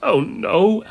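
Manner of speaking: high, loud whiny moan; whining refusal. A narrator's reading. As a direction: moan in dismay at being picked. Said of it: "Alice moaned".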